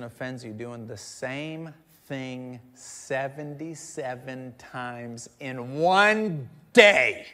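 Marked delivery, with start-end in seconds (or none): 2.7-7.3 "seventy-seven times in one" drawn out, then "day" bursts out loud; slow build to a shouted "day"; incredulous build. Acted. Preacher stresses an absurd count of offenses, incredulous, "day" shouted.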